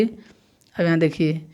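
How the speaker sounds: ordinary delivery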